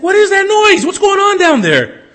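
high, loud voice jolted awake; startled shout. Acted. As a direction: yell like woken at midnight. Judge woken, widow screaming.

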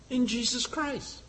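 ordinary delivery

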